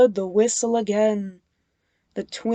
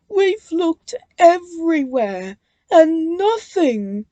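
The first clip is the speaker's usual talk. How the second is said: high, louder despairing cry; 8 semitones higher, 6 dB louder than usual. A narrator's reading. Bill after a fruitless search, crying out. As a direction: cry it out in defeat. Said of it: "cried Bill".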